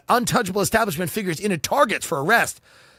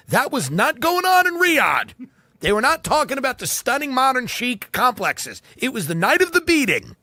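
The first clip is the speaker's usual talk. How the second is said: raised pitch, emphatic comic rant; mock indignation; 5 semitones higher than usual. Comic contrasting Riyadh with real estate blogs, mock-outraged. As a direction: drive it home with exaggerated outrage.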